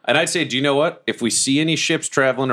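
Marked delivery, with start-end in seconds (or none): none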